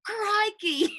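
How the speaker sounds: high, playful attempt at Australian accent; imitated Australian accent. Shown as a demonstration. Host echoing author's exclamation, imitated Australian accent. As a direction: exclaim it in a put-on Aussie accent. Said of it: "teach us a few phrases".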